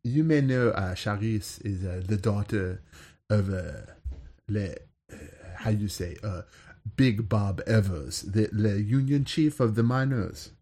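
put-on lightly done French Algerian accent; a touch of a North African French lilt. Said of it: "that's a French Algerian accent".

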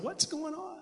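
high, hushed shepherd's question; wondering shepherds. Acted. Puzzled shepherds asking each other, high hushed wonder. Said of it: "These shepherds are wondering".

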